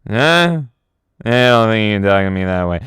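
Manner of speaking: mock drunk menace; lightly voiced drunk Orson Welles. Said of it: "Orson Welles being like".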